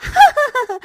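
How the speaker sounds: high, loud scornful laugh; mocking laugh. Swati laughing scornfully at the gift, high and loud.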